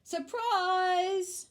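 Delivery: big, high, drawn-out shout. A narrator's reading. Amelia Bedelia joins in the surprise, shouting. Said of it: "shouted Amelia Bedelia".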